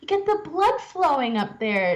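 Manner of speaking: a hint of grandmotherly voice; imitating a grandmother. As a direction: explain it as a grandmother would, pitched high. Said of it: "And she's like".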